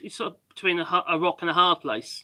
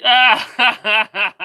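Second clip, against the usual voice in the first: real laugh; 10 dB louder than usual. Real, not acted. Laughing at a comment.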